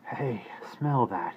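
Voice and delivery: slow, weak whisper; weakly. A narrator's reading. Shaken father trying to perk up over stew, weak slow whisper. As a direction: barely get it out, slowly. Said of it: "he said rather weakly".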